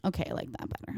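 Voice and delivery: voiceless whispered reply. Retold or imitated. Boyfriend accepting her idea, a soft whispered reply. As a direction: say it softly, barely voiced. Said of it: "he's like".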